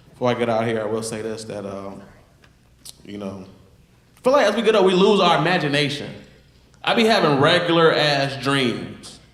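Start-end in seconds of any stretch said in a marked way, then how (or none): 4.2-9.2 loud rant. Acted. Stand-up bit, big energy opener.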